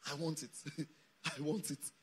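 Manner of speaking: low, soft, with a small chuckle; murmured, intimate repetition; quiet longing. Acted. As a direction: voice David's wish softly and low. Repeats David's longing, low, chuckling.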